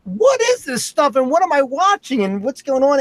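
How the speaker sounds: put-on high, bewildered questions from a stunned young viewer; amazed pitch jump, as if seeing brutal wrestling for the first time; astonished kid. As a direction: play the wide-eyed kid who can't believe what he's seeing. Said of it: "And I'm like".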